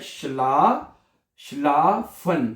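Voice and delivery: शलाफन stretched out slowly syllable by syllable; slow demo. Shown as a demonstration. Teacher spells it out for students, very slow. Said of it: "ये तो बहुत आसान है ना".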